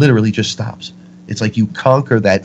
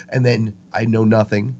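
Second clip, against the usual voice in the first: normal talking voice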